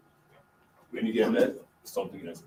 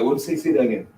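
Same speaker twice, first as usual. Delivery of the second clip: loud, high re-enacted double take; startled disbelief; 6 semitones higher, 13 dB louder than usual. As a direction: ask it back loudly, pitched up in surprise. Re-enacting his startled reply.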